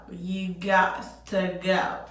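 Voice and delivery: slow, firm and deliberate; slow emphatic repeat. Retold or imitated. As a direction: spell it out slowly.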